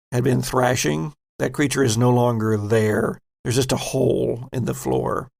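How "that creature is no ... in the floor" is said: normal talking voice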